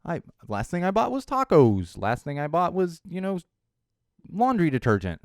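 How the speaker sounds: mock oblivious poster. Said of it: "are like".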